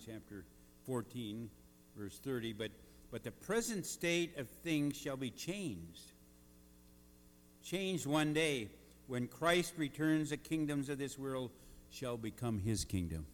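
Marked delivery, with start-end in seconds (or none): 3.1-13.3 booming motivational speech. Acted. Preaching at full force.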